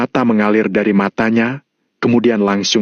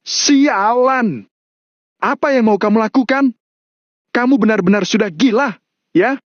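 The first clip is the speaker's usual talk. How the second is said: high, outraged outburst; high-pitched angry disbelief; alarmed anger; 12 semitones higher than usual. A narrator's reading. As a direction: curse and confront him in shock. Chris confronts Mario holding knife.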